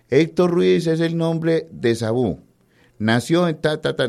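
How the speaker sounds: high, drawn-out mock recital; mock biography reading. Journalist mocking those who read out biographies, mock recital. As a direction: parody a droning read-out of facts.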